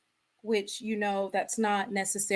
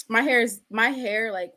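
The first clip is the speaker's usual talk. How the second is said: usual speaking voice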